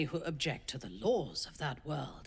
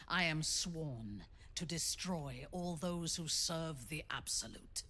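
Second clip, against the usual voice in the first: ordinary delivery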